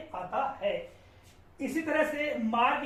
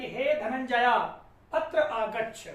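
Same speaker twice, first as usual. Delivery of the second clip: slow, louder call with Dhananjaya drawn out at the end; 3 dB louder, slower than usual. Shown as a demonstration. Teacher calling a distant friend, Dhananjaya drawn out. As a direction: call to someone far away, stretching Dhananjaya. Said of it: "प्लूत स्वर सुनाई देता है अंत में".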